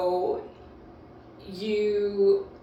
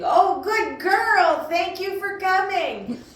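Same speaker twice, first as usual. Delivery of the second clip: high, loud, slow gushing praise to the dog; overjoyed praise for a returning dog; happy praise voice; 13 semitones higher, 8 dB louder, slower than usual. Shown as a demonstration. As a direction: sing it out like the best news ever. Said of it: "a smile in your voice".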